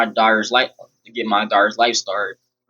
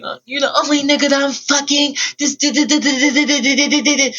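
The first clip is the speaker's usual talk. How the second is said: high squeaky imitation of her; hammed-up girl voice rattling on; mocking girly voice; 14 semitones higher than usual. Imitating girl's complaint.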